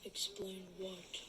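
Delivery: breathless unvoiced whisper. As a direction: whisper it, taken aback. Goodman cornered, shaken.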